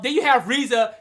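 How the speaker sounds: ordinary talk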